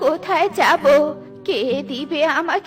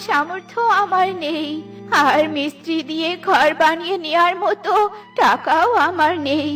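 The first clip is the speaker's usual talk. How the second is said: tearful, wavering lament; weeping old woman. Acted. Old Minu before her broken nest, crying in despair. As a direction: wail helplessly through tears. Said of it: "Minu বৃদ্ধা কান্না করছে".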